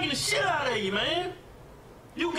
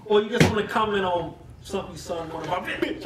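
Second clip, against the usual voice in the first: ordinary talk, nothing marked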